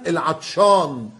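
usual speaking voice

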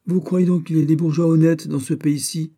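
sardonic retort. Acted. Sailor retorts to the officer, cheeky and loud. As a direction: throw the question back.

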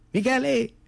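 put-on high child calling a name. Little brother calling Michele, high call. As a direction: call him from afar, up high.

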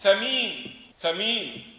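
ثمين as thameen, long ee; short tha, drawn-out meen in ثمين; third variant. Shown as a demonstration. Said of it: "أو تقول".

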